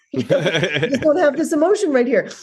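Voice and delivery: can't hold back a laugh at the memory; laughing through it. Retold or imitated. Laughing while retelling funny exchange.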